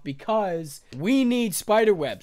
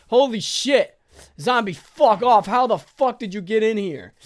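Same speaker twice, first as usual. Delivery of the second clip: 1 startled swearing; 4 semitones higher, 6 dB louder than usual. Real, not acted. Zombie inside the house.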